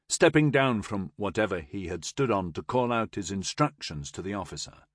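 plain reading voice

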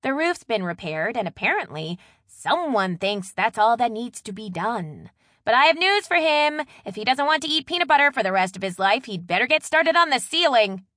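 indignant wife venting loudly; exasperated rant. A narrator's reading. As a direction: build a pointed complaint meant to be overheard. Loud pointed rant about husband.

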